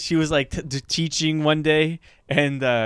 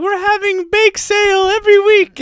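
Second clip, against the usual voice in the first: high, loud peppy announcement; hammed-up cheery school Instagram; mock cheerful school post; 7 dB louder than usual. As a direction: sell it like a chirpy school. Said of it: "they're like".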